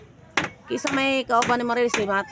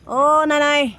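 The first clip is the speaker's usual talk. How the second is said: loud, raised, stretched greeting call; 6 semitones higher, 10 dB louder, slower than usual. Real, not acted. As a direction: hail her from a distance. Calls out to the old woman.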